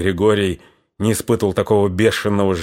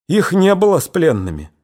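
shaken tearful witness; 7 semitones higher than usual. A narrator's reading. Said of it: "коротко, сбивчиво рассказала".

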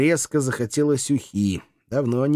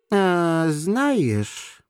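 higher, drifting musing; offhand pondering; not listening; 9 semitones higher than usual. A narrator's reading. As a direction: carry on as if nobody spoke. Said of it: "не особо вслушиваясь, продолжил".

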